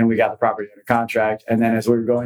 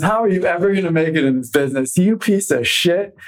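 higher, hammed-up angry berating; furious cussing out; 7 semitones higher than usual. Manager berating rookie agent behind a closed door, furious. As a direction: tear into the rookie like a furious boss.

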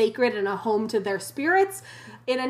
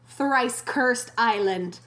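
slow ominous; much slower than usual. Acted. Ominous title, said slowly.

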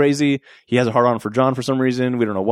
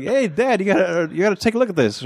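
a lightly voiced kid, higher, calling to his dad; 7 semitones higher than usual. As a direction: call out like an excited kid.

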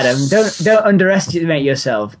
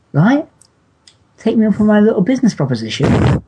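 shady offer; 9 semitones higher, faster than usual. Pretend. Sinister sales pitch offer.

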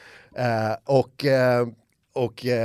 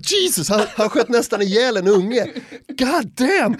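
shocked; 9 semitones higher, 4 dB louder, slower than usual. Retold or imitated. Shocked aside to Thomas.

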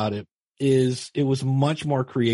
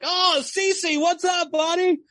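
mock crowd greeting, pitched up high; Cheers-style greeting; slower than usual. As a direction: call him in like a bar full of regulars. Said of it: "literally it was like cheers".

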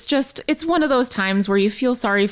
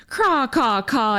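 bird calls; 3 semitones higher, slower than usual. Retold or imitated.

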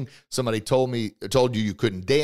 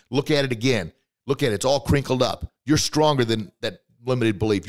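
ordinary delivery

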